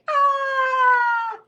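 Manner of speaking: high, loud sustained scream; long panicked yell. Acted. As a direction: yell it out in a frenzy. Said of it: "ran around the house yelling".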